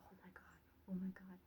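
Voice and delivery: hushed, breathless oh my god; awed whisper. Retold or imitated. Reacting to chemtrail videos, a scared hushed whisper. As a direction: whisper it like you're spooked.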